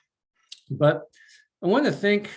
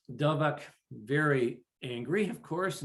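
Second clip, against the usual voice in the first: plain reading voice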